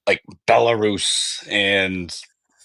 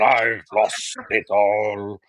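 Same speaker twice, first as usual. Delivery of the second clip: slow, softer mock Russian repeat; Russian accent, quieter echo; much slower than usual. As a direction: let I've lost it all fall away, defeated. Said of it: "Wasn't my best Russian impression".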